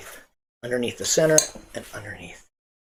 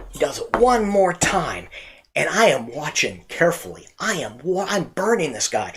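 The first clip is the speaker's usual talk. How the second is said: normal talking voice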